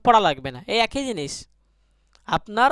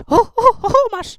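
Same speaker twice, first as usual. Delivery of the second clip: high, repeated "hu" hoots; playful hooting; 12 semitones higher than usual. Shown as a demonstration. Teacher playing with a drill word, high hooting. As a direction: hoot the syllable high before finishing the word.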